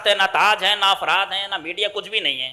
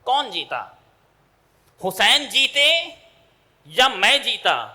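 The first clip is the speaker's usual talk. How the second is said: taunting king; 5 semitones higher, 4 dB louder, slower than usual. Acted. Yazid asks mockingly who won.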